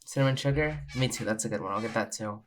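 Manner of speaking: ordinary talk, nothing marked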